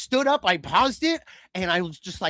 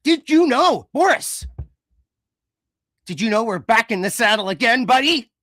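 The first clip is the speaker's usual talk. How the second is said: loud, higher sarcastic announcer; loud mock announcer; 5 semitones higher, 6 dB louder than usual. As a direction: taunt Boris like a TV promo.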